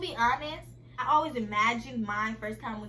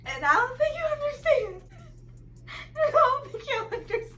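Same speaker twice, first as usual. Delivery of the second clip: high, loud, laughing through it; laughing excitedly; 6 dB louder than usual. Real, not acted. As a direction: gush with giddy laughter. Gushing with laughter to viewers.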